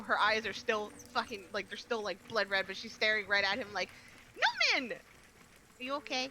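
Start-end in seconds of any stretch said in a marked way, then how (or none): 4.8-6.3 soft concern. Acted. Character checks on fallen ally.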